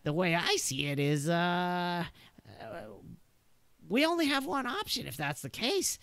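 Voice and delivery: a nervous gunman setting terms; wary NPC voice. Acted. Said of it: "he's like".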